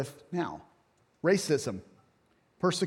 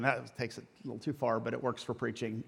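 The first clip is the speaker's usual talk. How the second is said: laughing aside; 5 semitones lower, 9 dB quieter than usual. Real, not acted. Admits bacon goes too far.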